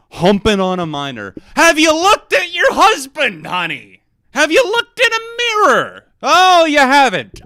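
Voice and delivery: high, loud, over-the-top diva scold; sassy mock scold. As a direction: clap back like a furious drag queen. Mock-scolding Nicki in outrage.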